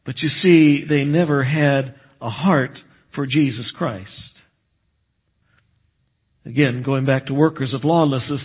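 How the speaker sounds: ordinary talk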